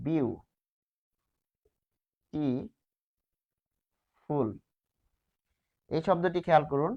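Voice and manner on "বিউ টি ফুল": বিউ, টি, ফুল with long pauses between syllables; split into syllables. Shown as a demonstration. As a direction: say বিউ, then টি, then ফুল, each on its own. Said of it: "আমরা syllable ভাগ করছি".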